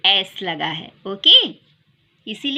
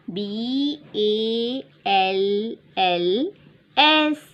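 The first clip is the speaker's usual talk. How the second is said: slow spelling out; much slower than usual. Shown as a demonstration. Teacher spelling balls slowly for children. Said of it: "तो यहाँ पर क्या बनेगा बेटा".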